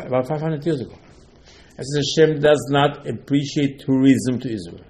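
ordinary delivery

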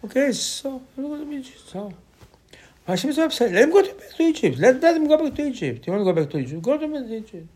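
exasperated Hashem. Retold or imitated.

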